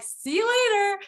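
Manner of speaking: put-on high, breezy kid's goodbye; a tiny kid skipping off; high child voice waving goodbye. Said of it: "They were like".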